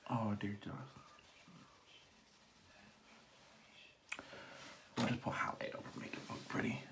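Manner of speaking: hushed, breathy resignation; whispered despair. Real, not acted. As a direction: mutter it under your breath. Mutters in despair.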